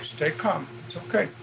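soft, hushed reassurance; calm her down gently; quiet comforting voice; soothing. Real, not acted. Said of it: "detective soothing crying woman".

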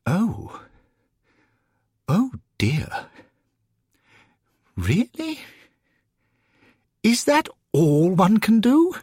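high-pitched, polite dismay with long hesitations; dismayed gentleman newcomer. Acted. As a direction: let the disappointment show in a high voice. Newcomer disappointed by afterlife.

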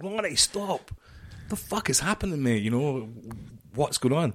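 high, pressing call after a running mate; calling after a friend running down the corridor; urgent call. Retold or imitated. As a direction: demand to know what's happening. Shouting after running Ronnie.

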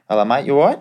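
cheery Aussie agent checking in on his player; Australian agent impression. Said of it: "he's Australian, it's quite funny".

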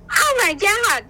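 high, loud excited gasp; imitating her own thrilled outburst; excited shout. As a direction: burst out with delight. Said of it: "all I would go is".

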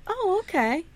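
high, meek mock agreement; meek father. Father giving in to the charlatan, high and meek. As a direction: cave in, lightly and high. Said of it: "Timmy's father goes".

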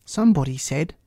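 usual speaking voice

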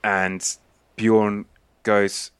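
usual speaking voice

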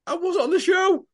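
very high-pitched mimicked excuse; mock protesting excuse. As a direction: squeak it out as a put-on protest. Mimicking everyone's excuse on the street.

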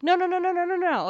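high-pitched string of panicked refusals; retold alarm. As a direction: frantically try to stop the driver. Retold frantic stop to driver flooring it.

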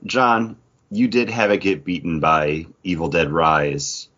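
normal talking voice

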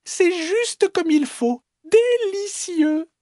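very high, slow, savouring voice; delighted little fox. Acted. Little fox loving the small bowl's soup, squeaky delight. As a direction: squeal slowly with relish. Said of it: "s'écrie-t-elle".

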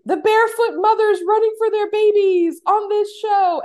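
high, loud, drawn-out declaration; passionate proclamation. Acted. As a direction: proclaim it with mock-dramatic fervor. Reading out own passionate notes.

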